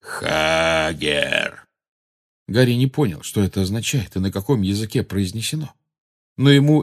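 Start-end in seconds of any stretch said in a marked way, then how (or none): 0.0-1.7 drawn-out, lower giant's call; lumbering giant voice. A narrator's reading. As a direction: stretch the word out like a slow, huge giant. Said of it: "Он открыл рот ещё шире".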